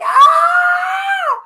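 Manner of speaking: high, loud shriek; panicked scream. Retold or imitated. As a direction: scream in helpless alarm. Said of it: "I was like".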